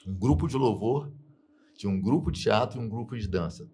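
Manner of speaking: normal talking voice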